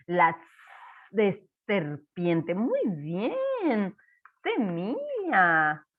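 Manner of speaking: very slow, sounding it out; teacher sounding out "Semilla" for a child; slow spelling aloud. Shown as a demonstration. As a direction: draw the word out as you write it. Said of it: "spelling word while writing".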